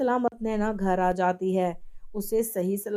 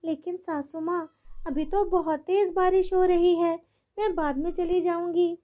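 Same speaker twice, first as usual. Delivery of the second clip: timid daughter-in-law; 7 semitones higher than usual. Acted.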